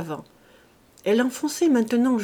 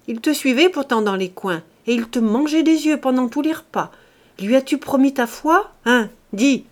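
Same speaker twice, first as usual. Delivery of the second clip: loud, higher, pressing accusations; angry interrogation; 4 semitones higher, 7 dB louder than usual. A narrator's reading. Farmer questioning servant about her promise, loud and insistent. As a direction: press her hard and demand an answer.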